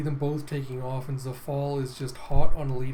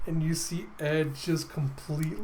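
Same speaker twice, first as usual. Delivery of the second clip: plain reading voice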